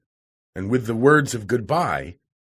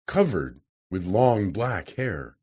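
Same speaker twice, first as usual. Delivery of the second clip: ordinary delivery, nothing marked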